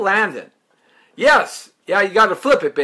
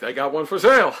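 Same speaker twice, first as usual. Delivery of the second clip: normal talking voice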